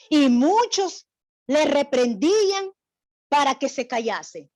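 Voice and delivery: scripture reading. Acted. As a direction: read the verse out firmly.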